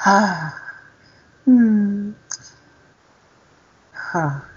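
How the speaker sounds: high mock sighs and hums; imitated sighing. Storyteller mimics the cleaner hovering near the hospital bed, imitated sighing. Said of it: "I would be hearing her going".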